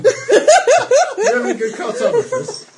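high, slow, cracking up; laughing. Real, not acted. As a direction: ask it while laughing hard. Question collapsing into laughter.

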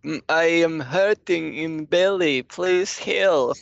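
high, hammy fake sickness; faking frailty. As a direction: whimper like you're sick, hammed up. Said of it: "Someone pretend to be sick".

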